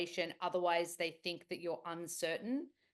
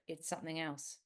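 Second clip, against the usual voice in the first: ordinary delivery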